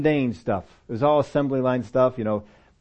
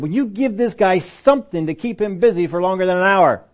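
raised, exasperated boss voice; frustrated boss; 6 semitones higher, 4 dB louder than usual. Retold or imitated. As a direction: vent the boss's frustration, higher and louder. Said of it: "my boss got frustrated and he said".

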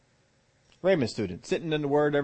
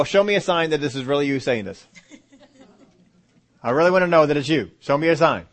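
mock demand; 4 semitones higher, 7 dB louder than usual.

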